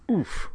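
high, short puff of "oof"; burdened oof. A narrator's reading. Girl with someone jumping on her back, oof. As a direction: let it out as if weight lands on you. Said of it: "with such a burden so suddenly imposed".

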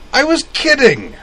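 very high blurted defense; blurting. A narrator's reading. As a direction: blurt it out fast. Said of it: "Eric blurted".

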